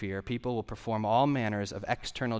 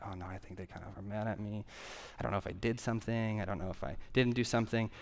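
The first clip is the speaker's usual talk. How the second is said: soft, quick worried mutter to yourself; anxious inner voice; 2 semitones lower, 8 dB quieter, faster than usual. Retold or imitated. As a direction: fret under your breath.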